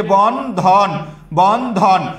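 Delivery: বন ধন broken into bon and dhon, each drawn out; two separate syllables. Shown as a demonstration. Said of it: "ইংরেজিতে বলি এরকম". (বন ধন দুই ভাগে টেনে উচ্চারণ)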